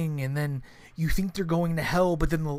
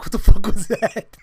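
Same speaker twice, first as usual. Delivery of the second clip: much louder, laughing through the words; shocked laughing shout; 4 semitones higher, 13 dB louder than usual. Retold or imitated. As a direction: blurt it out stunned and laughing. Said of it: "He's like".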